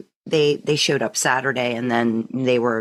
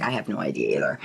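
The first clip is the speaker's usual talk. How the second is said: husband's voice dropped much lower and softer; imitating husband; 14 semitones lower, 5 dB quieter, faster than usual. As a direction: lightly mimic Ted, low and muted. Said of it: "Ted's like".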